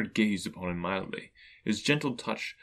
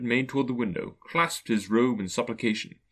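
ordinary delivery

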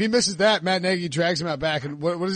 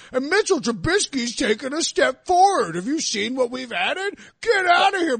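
a hammed-up cocky fan, higher and shouting at the peak; mock the boastful Bears fans; lightly voiced smug fan rising to a mock shout; mocking an overconfident fan; 5 semitones higher than usual. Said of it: "using a non-Southern accent to make fun of these".

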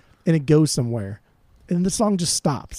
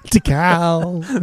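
ordinary talk, nothing marked

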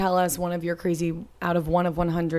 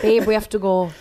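mock British; 3 dB louder than usual. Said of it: "That was a little more British".

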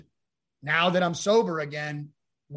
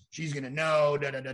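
ordinary delivery

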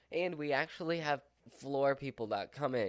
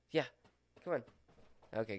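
usual speaking voice

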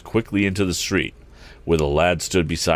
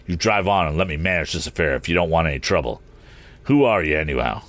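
plain reading voice

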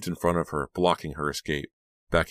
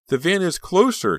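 high, loud, urgent call; breathless cry; 14 semitones higher, 6 dB louder than usual. A narrator's reading. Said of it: "she cried breathlessly".

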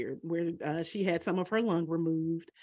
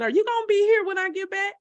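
anxious; 13 semitones higher, 6 dB louder, faster than usual. Retold or imitated. Anxious question to dying mother.

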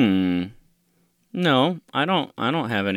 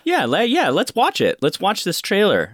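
excited; 12 semitones higher, 5 dB louder than usual. Real, not acted. Eager to start trailer.

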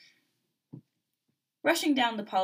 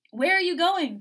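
high, loud, urgent question; raised-voice call; calling after someone; 9 semitones higher, 8 dB louder than usual. A narrator's reading. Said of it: "Mark called as Yuki stormed away".

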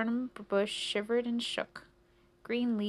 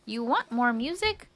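oblivious question, raised and louder; cricket mishearing; 4 semitones higher, 5 dB louder than usual. Acted. Cricket mishears the mouse's complaint, mildly eager. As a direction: ask it brightly, as if offering a favour.

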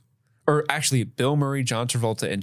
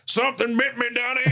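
laughing, much higher mock Walter voice; mock John Goodman; 13 semitones higher than usual. Friend riffing on John Goodman's Forrest, hammed-up high laughing voice. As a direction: ham up a John Goodman Big Lebowski line through laughter. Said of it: "John Goodman version of this movie".

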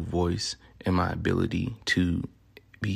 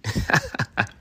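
laugh; 4 semitones higher than usual. Real, not acted. Laugh at own French.

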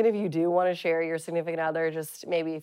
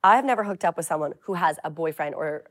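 ordinary talk, nothing marked

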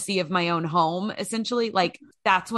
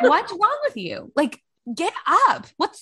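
higher, slower mock scold at herself; imitating yelling at herself, pitched up; mock self-scolding; 9 semitones higher, 3 dB louder than usual. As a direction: berate yourself to get out of bed. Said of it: "I was like".

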